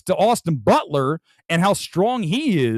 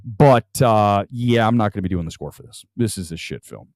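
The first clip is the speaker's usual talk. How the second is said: composer turning down a film; 7 semitones lower than usual. Retold or imitated. Host imitating composer rejecting a bad script, lightly voiced and mild. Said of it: "I would just look at that script and go".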